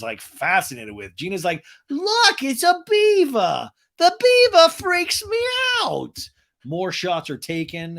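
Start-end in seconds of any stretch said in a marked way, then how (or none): 1.9-6.2 high, loud squeal; mock freaked-out shriek. Gina spotting the taxidermy beaver, in a mock shriek.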